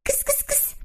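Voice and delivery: breathy high kitty call; whisper-call the kitten. Acted. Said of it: "всё время звал".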